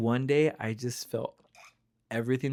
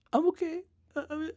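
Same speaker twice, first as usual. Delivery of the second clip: very high, hammed-up weepy voice; mock crying. Retelling a breakdown at Coldstone, mock crying. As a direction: imitate yourself bursting into tears, high-pitched. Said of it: "I just start crying".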